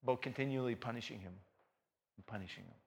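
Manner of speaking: fast, soft drop-off; sudden hush. Real, not acted. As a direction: let it fall away quietly. Drops after the climax.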